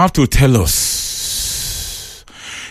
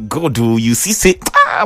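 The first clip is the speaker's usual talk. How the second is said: normal talking voice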